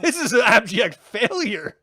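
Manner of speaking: loud, high-pitched mock scolding; mock judge outburst. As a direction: play the outraged judge shutting down the cheering. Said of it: "and Paul is like".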